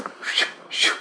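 breathy shoo of a line swishing through the air; whooshing casting sound. Retold or imitated. Telling a friend about his false casts, with whooshing shoos. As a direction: mimic each false cast whipping past.

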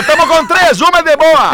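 loud, high, hammed-up replay of the clip; imitating the audio. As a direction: shout it back like the guy in the audio. Repeats the played audio clip. (imitando o áudio aos gritos)

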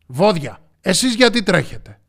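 loud lion's demand; forceful storybook interrogation; commanding question. A narrator's reading. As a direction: question them like a stern king. Lion interrogates the animals.

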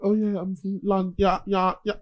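mock English accent, casual speaking voice; put-on English accent, loosely mumbled. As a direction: imitate a laid-back English singer in interview. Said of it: "but then he talks like".

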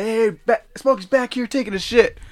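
high-pitched mock announcement; imitated blabbermouth. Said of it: "turns around and tells everybody".

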